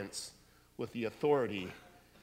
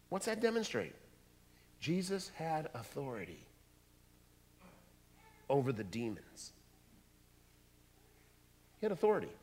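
ordinary talk

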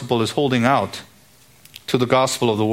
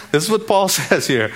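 laughing; 3 semitones higher than usual. Real, not acted. Laughing while quoting Paul.

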